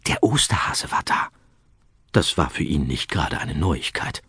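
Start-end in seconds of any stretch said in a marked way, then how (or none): 0.1-1.3 put-on whispered secret to a child. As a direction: whisper the news to him.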